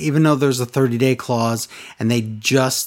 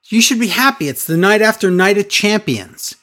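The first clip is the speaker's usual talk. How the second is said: excited Rollins; 6 semitones higher than usual. Retold or imitated. Rollins voiced cheerfully.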